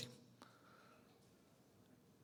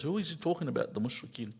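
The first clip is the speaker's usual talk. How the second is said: ordinary talk, nothing marked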